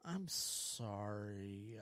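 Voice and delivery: sigh, then a low, drawn-out sorry; very slow, soft, reluctant sympathy; awkward retold apology. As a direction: sigh out a half-hearted apology. Retold awkward response to crying employee.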